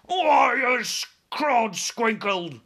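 high, loud, slow yowl; yowling giant. Acted. Chained giant yowls in protest, high and loud. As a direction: yowl it out like an angry cat. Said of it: "yowled the blood bottler".

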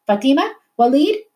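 high searching call; calling names across the cells. A narrator's reading. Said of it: "Mahmoud's mother called".

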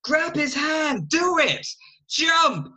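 high, loud, slow urging yell; hammed-up yell for her to jump; urgent shout. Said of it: "Jackie is like".

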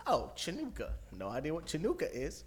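lightly voiced oblivious star reading aloud; mock clueless celebrity. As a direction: imitate someone breezily reading an unknown word. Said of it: "he's just going, going and reading".

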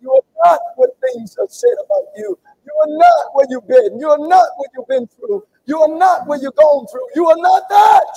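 loud, high, building proclamation; shouted declaration. Real, not acted. Preacher declaring over the congregation, shouting. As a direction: shout each "not" like a verdict.

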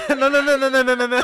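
ordinary delivery

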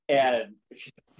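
usual speaking voice

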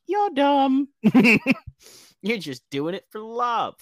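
high, loud teasing jeer with a laugh; hammed-up mates jeering; mock schoolboy taunt; mocking friends.

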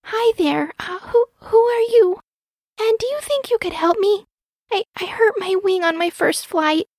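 put-on high, halting child voice; pleading baby owl. A narrator's reading. Baby owl with hurt wing asking mouse, pleading. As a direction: ask for help high and hesitant.

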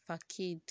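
facade misread as fa-KADE; old wrong pronunciation. Shown as a demonstration. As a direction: say facade with a hard k, rhyming with made. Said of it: "like I used to call it".

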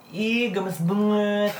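slowly gushing "Ih, gemes banget"; cooing "gemes banget". Shown as a demonstration. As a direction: stretch out "gemes banget" as if gushing over something cute. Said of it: "banget gini".

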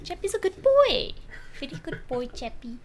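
high, doting South African accent. Shown as a demonstration. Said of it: "You have to say it in, like, the South African accent".

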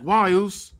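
lightly voiced pleading wrestler; voicing Jimmy. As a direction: plead with your twin, lightly acted. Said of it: "He's like".

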